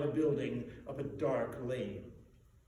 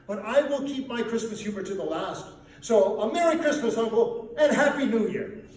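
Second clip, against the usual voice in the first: louder, higher, laughing cheer; cheerful insistence; 5 semitones higher, 8 dB louder than usual. A narrator's reading. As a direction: beam it back at him. Nephew's cheery farewell.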